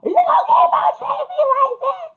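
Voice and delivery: high, tearful re-enacted wail; screaming and hollering. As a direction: act out a hysterical screaming fit. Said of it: "I was screaming and hollering so much".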